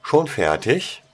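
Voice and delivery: loud, high call across the room; asked loudly. A narrator's reading. Prefect loudly asks the studying boy, high and loud. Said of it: "fragte Penelope laut".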